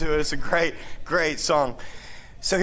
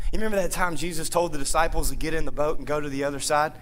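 ordinary talk

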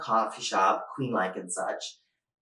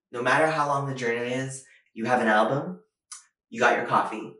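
normal talking voice

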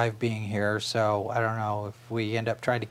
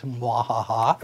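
mad scientist; slower than usual. Pretend. Brewing plans, teasing evil laugh.

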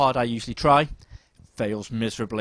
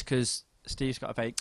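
ordinary delivery

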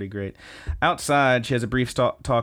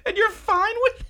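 incredulous; 4 dB louder than usual. Pretend. Host's mock outraged reaction.

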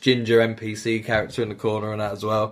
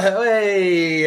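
mock outraged hey; 9 semitones higher, 3 dB louder than usual. Outraged reaction to engineer.

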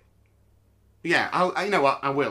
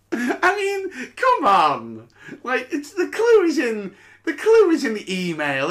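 higher and louder, laughing in disbelief; exasperated laughing; 10 semitones higher, 6 dB louder than usual. Real, not acted. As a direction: laugh it off, fed up.

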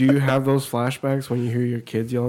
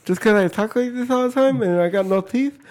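put-on toothless kid; 6 semitones higher than usual. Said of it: "He's like".

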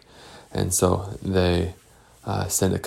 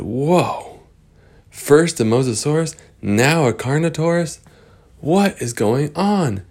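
put-on astonished kids; 7 semitones higher than usual.

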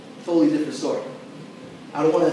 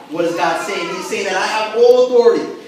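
louder, higher charge to the congregation; preaching at full force; 4 semitones higher, 6 dB louder than usual. Acted. Pastor charging the church with the mission, loud and higher. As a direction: drive it home like a call to arms.

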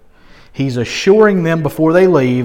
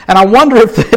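ordinary talk

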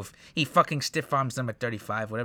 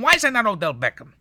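much higher and louder, disbelieving yell; exasperated outburst; 10 semitones higher, 8 dB louder, faster than usual. Retold or imitated. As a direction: throw up your hands at the screen. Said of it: "I'm going".

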